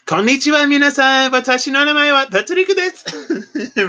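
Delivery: mock high-voiced self-introduction; high girly voice. Shown as a demonstration. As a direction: pitch the Japanese way up like a girl would say it. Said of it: "not like".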